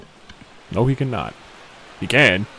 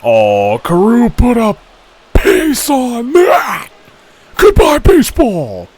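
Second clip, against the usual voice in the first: loud, higher excited shout; home run call; 7 semitones higher, 6 dB louder, slower than usual. Real, not acted. Streamer calling a homer in the game, loud excited shout. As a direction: ride the call up to a big goodbye.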